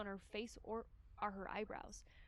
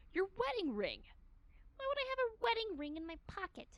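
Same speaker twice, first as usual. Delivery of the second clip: stern detective woman; 9 semitones higher than usual. Acted. Shigure voiced in visual novel.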